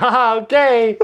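high, drawn-out uneasy okay; awkward ah okay. Retold or imitated. To the pushy server, a high awkward okay. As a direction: let it hang awkwardly. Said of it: "we were like".

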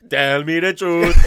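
loud, slow hammed-up Jamaican accent; mock Jamaican accent. Mocking the co-host's Hook, in a loud Jamaican accent. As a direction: mimic it as booming mock Jamaican. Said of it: "Why is he [name]?".

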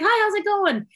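high, cheery "Hi, how's it going?"; friendly upbeat hello; bright greeting. Retold or imitated. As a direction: sing it out sweetly.

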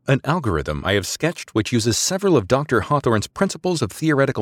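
crisp, formal subordinate making a case; precise female officer. Acted. Maradesi explains her algorithm.

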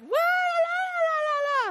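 high, shouted gibberish wail; mock hysterical wailing. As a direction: imitate a hysterical crying tirade in wordless yells. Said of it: "hysterically crying, yelling at me".

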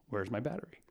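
quiet, puzzled "Where's my battery?" asked half to himself; mild puzzled ask. Retold or imitated. First calm ask for battery.

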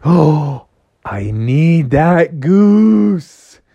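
drawn-out, loud longing; awed wanting. Acted. Froggy spots the golden goose, covetous. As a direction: stretch the words with greedy wonder.

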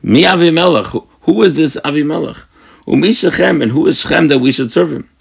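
mock-loud defiant taunt; retold loud challenge. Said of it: "says very loudly".

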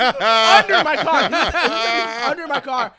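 loud, drawn-out storytelling yell; incredulous retold shout. Storyteller reveals the man under his car, loud slow incredulous yell. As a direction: stretch it out at full volume.

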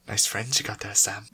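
unvoiced teasing whisper. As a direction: whisper it slyly.

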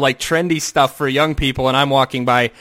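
ordinary talk

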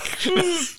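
high giggle bursting out; snorting laugh held back; stifled giggles. Real, not acted. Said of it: "Cannot keep a straight face".

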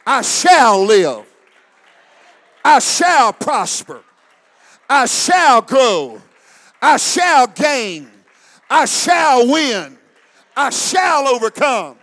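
slow, loud declarations, shouted out; loud, drawn-out shouted affirmations; congregation shout. Acted. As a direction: bellow each line slowly like a battle cry. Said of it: "Somebody shout with me".

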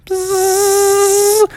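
long high buzzing bzzz; bee buzz. A narrator's reading. Bees coming up behind the players, buzzing. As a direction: imitate bees closing in. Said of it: "the bees were coming".

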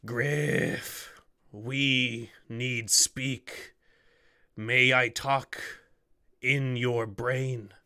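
very slow, broken, halting words; halting alien creature. Acted. An alien creature first speaking to Griff, halting. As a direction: struggle with every word of a foreign tongue.

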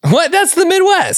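high-pitched incredulous protest; indignant disbelief. Real, not acted. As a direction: squeak out your objection in disbelief. Protests that his accent was criticized.

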